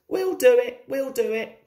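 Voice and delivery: mildly breathless determination; panted. A narrator's reading. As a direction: urge yourself on with a slight pant. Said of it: "panted Thomas".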